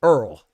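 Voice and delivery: Earl said as one syllable, "url"; pronounced Earl. Shown as a demonstration. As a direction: squeeze Earl into a single syllable. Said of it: "how many times they call Errol".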